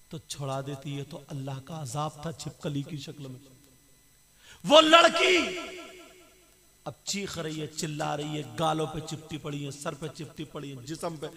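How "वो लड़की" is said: shriek. Acted. Preacher's sudden shrieked climax.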